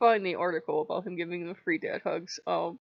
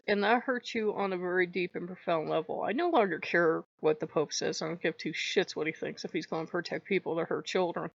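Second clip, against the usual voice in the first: normal talking voice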